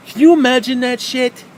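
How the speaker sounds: loud, high, exasperated; ranting shout at the picture; incredulous outburst. Real, not acted. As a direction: blow up at the absurd idea. Repeated, much louder and higher.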